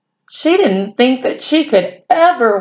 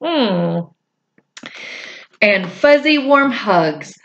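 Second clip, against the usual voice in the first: plain reading voice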